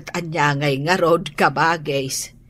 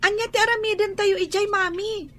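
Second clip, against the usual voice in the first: much higher and fast, a startled question; surprised child; 12 semitones higher, 5 dB quieter, faster than usual. Acted. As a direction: blurt it out, caught off guard. Child surprised by Manila trip. (nasdaaw nga ubing, napartak ken nangato ti timek)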